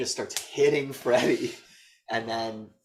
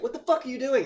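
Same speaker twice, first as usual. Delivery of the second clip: alarmed teacher; 13 semitones higher, 4 dB louder, faster than usual. Retold or imitated. Teacher's alarmed text voiced.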